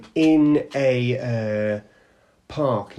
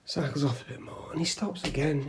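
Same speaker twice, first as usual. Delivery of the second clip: ordinary talk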